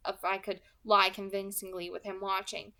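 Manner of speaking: ordinary delivery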